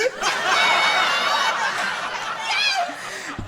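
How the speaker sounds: long high burst of real laughter; laughing. Real, not acted. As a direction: let the laugh run high and free. Teller laughs at kid's line.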